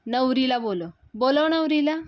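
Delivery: insist, rising to a shout; loud demand. Retold or imitated. Sulakshana demanding Yash call the bride, loud.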